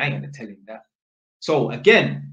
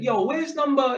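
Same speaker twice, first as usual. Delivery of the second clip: pitched-up casual question; high friendly ask; 11 semitones higher than usual. Retold or imitated. Electrician asking stranger for the flat, high friendly ask.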